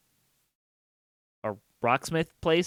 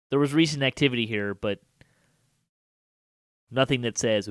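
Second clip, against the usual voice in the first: usual speaking voice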